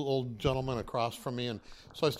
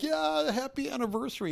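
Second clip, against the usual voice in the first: put-on much higher, excited cry of the old man; 14 semitones higher, 4 dB louder than usual. Widower joins the anniversary prayer, imitated excited cry. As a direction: imitate the widower's joyful yell. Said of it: "And he's like".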